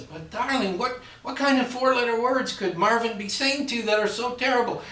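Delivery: coaxing, dying to know; curious mother. Acted. Mother asking her daughter what he said, high and doting.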